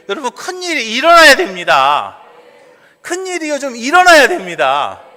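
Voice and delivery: loud preaching with surges on key words; exhorting preaching. Real, not acted. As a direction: urge the congregation forcefully. Preaches at full force, exhorting. (힘차게 촉구하는 설교)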